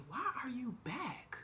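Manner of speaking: slow high whisper; whispered shocked question. Retold or imitated. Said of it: "she said".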